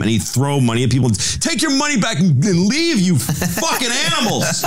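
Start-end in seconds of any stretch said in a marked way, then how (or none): 1.1-4.6 much higher, hammed-up furious rant with a laugh; mock screaming Larry. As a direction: throw insults like a mock tantrum. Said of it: "start screaming at them".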